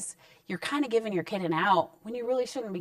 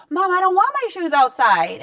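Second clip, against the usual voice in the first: put-on higher, louder whiny kid voice; son's protest; 9 semitones higher, 9 dB louder than usual. Son protesting to mom about his shoes going outside, higher whiny voice. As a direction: plead like a kid.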